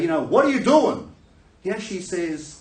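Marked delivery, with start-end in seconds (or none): 0.2-1.1 high, loud mock scolding; berating. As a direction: tear into him in acted outrage. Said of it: "he doesn't berate him".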